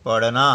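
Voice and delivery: पढ़ना with the ढ़ flap said clearly and loud; word demo. Shown as a demonstration. Scene: teacher shows how students should say it, firm demo. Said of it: "ऐसे बोलेंगे".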